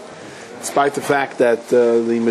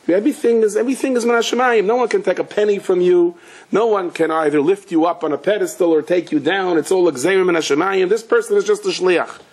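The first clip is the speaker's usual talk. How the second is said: higher, impassioned sermon delivery; preaching build-up; 6 semitones higher than usual. Acted. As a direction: lift the voice as the point lands. Rising preaching passage.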